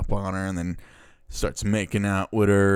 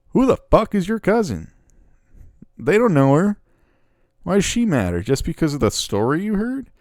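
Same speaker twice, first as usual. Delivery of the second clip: loud, high-pitched incredulous outburst; indignant disbelief; 9 semitones higher, 6 dB louder than usual. Acted. Viewer challenging a film character over the cousin, indignant. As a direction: demand an answer, voice jumping up high.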